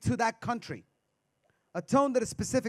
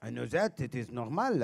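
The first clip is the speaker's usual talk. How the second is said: put-on low, soft French accent; dismissive French indignance; French guy; 10 semitones lower, 6 dB quieter than usual. Comedian mocking a Frenchman, low soft French accent. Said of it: "French guy".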